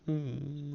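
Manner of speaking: slow, soft high whimper; mock whimper. Teen imitating mom's quiet crying, a soft whimper. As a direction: hum a little sob sound. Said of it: "a little crying action".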